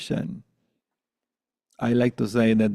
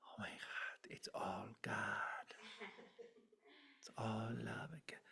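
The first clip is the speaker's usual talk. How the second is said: hushed blissful whisper; awed whisper; 23 dB quieter than usual. Pretend. Teacher mocking the dream of future enlightenment, awed whisper. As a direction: breathe it like a sudden revelation. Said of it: "be like".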